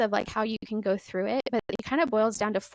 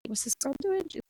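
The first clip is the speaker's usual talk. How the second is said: lightly mimicked husband, higher-pitched on "crazy"; baffled onlooker; 8 semitones higher than usual. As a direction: imitate a bewildered onlooker, pitch lifting at the end. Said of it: "my husband and others are like".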